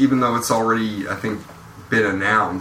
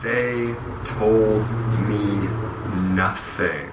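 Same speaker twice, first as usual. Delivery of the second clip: slow, spaced-out words sinking lower and softer; word-by-word punchline; 3 semitones lower, 3 dB quieter, much slower than usual. Real, not acted. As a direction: let each word drop separately. Drawn-out punchline, told no secrets.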